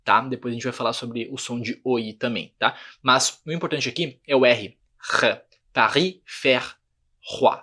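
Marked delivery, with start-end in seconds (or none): 5.2-7.6 "r", "Paris", "faire", "roi" one by one, slowly, R in the throat; slow drill of guttural R in "Paris, faire, roi"; throaty French R. Shown as a demonstration. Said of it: "Com a garganta".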